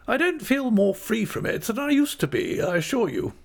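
very high, emphatic aunt's voice; cheerful aunt. A narrator's reading. Aunt confessing her own age to Dora, cheerful. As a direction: assure her brightly, pitched right up. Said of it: "said my aunt cheerfully".

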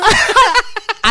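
high-pitched giggle; high laugh. Real, not acted. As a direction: burst into laughter. Laughing at joke.

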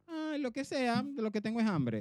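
high, careless whatever tone; indifferent reply. Retold or imitated. Retelling answering his partner about dinner, high and indifferent. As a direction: wave it off, just hungry.

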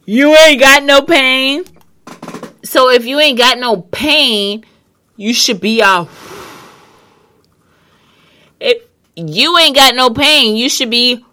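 loud and high; excited. Real, not acted. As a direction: push it up, fired up. Rising emphatic argument.

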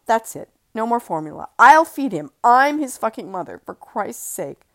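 loud, hard angry declaration; furious. Acted. Mother furious at husband over formula, loud. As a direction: slam it down in fury.

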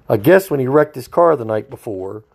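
ordinary talk

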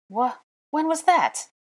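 high, loud, drawn-out disbelief; baffled. A narrator's reading. He questions her claim, loudly baffled. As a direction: stammer out an incredulous question.

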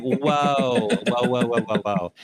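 mock overwhelmed string of whoas; baffled reaction. Interviewer mimics being lost at hair jargon, baffled. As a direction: say it as if asking someone to stop and explain.

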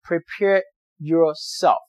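prepare yourself slowed right down and leaned on; stress on prepare yourself. Shown as a demonstration. Advising students before interviews, slow and emphatic. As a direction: spell out prepare yourself slowly, word by word. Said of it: "remember the word".

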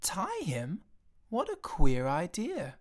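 slow, rising shocked disbelief; a boy appalled at tying the sheep. A narrator's reading. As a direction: recoil at the very idea. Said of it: "seemed shocked by this offer".

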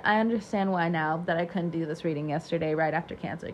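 usual speaking voice

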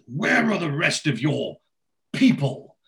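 pause, then punch "people"; disdainful emphasis. Retold or imitated. Arthur asking Dennis about the rest, disdainful on "people". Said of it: "the way that he says".

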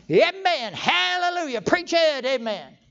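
loud, high, drawn-out church shouts; shouted amens. Retold or imitated. Stirred churchgoer cheering on his pastor, exuberant high shout. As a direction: burst out hollering from the back pew. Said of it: "before I know what I'm doing, I'm saying".

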